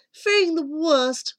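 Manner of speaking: ordinary delivery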